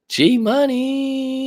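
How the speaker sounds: very high, long held final note; high sing-song call, stretched out; playful shout-out. Pretend. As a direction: squeal the nickname and hold it.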